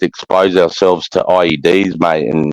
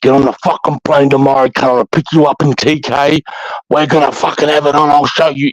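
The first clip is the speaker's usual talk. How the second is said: raised, high-pitched angry challenge; retold yelling; 8 semitones higher than usual. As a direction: re-enact yelling down the phone, voice pitched up.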